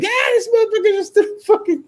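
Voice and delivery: high cackling glee; gleeful disbelief. Retold or imitated. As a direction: squeal it like you can't believe your luck. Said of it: "I'm like".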